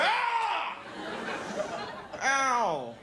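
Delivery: sudden high yelp then ow; mock yelp of pain at a hair on the arm; startled yelp. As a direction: jump at a phantom mosquito bite, yelping. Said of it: "And you go".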